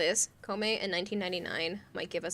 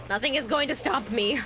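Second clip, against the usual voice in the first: eager and loud; can't wait, pumped up; excited; 5 semitones higher, 5 dB louder than usual. Real, not acted. Excited about game finals.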